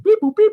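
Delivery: loud, high computer beeping; robot beeps. Retold or imitated. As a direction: beep like a cartoon computer. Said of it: "Ziggy beeping again".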